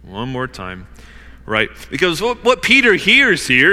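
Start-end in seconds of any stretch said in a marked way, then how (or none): 0.0-0.9 low, soft, weary aside; eye-rolling. Retold or imitated. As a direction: imitate mild exasperation under the voice. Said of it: "kind of rolls his eyes and was like".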